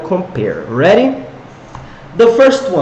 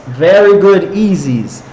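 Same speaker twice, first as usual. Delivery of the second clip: ordinary delivery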